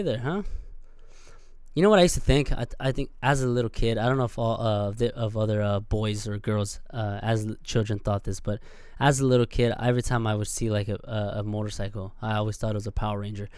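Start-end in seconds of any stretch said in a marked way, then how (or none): none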